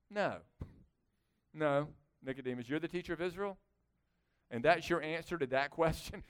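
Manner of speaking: low, soft, dry put-down; understated sarcasm, dropped in pitch and volume; deadpan disbelief at a teacher's answer; sarcastic. Acted. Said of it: "sarcastically looks back at him and goes".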